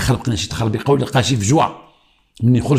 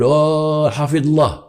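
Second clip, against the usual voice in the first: higher, long-held ceremonial cry; cavalry leader's call; 5 semitones higher, much slower than usual. Retold or imitated. Leader calling to his horsemen in fantasia, imitated drawn-out call. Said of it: "شكون هو العلام؟ العلام في الخياله".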